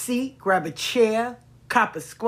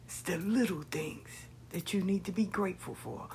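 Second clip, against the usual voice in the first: whispered; 3 semitones lower, 12 dB quieter than usual. Real, not acted. Intimate lesson on gratitude.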